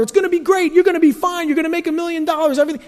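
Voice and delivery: high, bright salesman promises; mock TV hype man. Pastor mocking a television promise-seller, hyped and high. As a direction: pump it up like a TV huckster. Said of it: "guy telling you out there".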